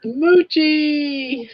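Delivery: high, loud, delighted call of the dog's name; excitedly calling to the dog; excited greeting. Real, not acted. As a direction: squeal the dog's name with joy.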